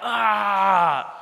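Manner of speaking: mock yell of exasperation; mad yell. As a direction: let out a pretend angry "ah". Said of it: "I would have been so mad".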